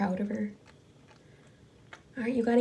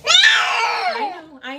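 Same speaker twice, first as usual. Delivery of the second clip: high crying shout; anguished cry; 9 dB louder, much slower than usual. Real, not acted. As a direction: cry out in sympathy. Cat crying in pain.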